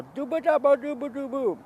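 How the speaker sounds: high, loud gibberish babble; talking weird. Retold or imitated. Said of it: "talking weird, right?".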